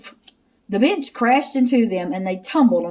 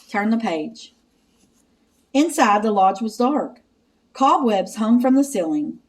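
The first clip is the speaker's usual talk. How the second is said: plain reading voice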